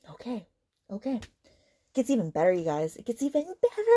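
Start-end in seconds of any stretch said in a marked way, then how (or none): 3.0-3.8 high, rising mock delight; sarcastic teasing. Real, not acted. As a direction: repeat it climbing up high in exasperated irony.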